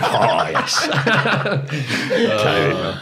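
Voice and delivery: cheeky reply spluttered through giggles; laughing. Retold or imitated. Answering Bob Malcolm's threat, laughing and high.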